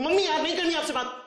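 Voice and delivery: mock yell at mom. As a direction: snap at mom like a fed-up teenager. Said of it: "मन कर रहा कि चिल्ला दूं".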